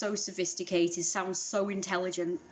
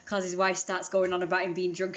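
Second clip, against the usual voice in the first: normal talking voice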